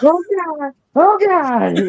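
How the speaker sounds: high, loud mock-terrified cry; mock panic. Friend joining the story, crying out in mock fear. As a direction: yelp like you're in that spinning car. Joining the retold car scare.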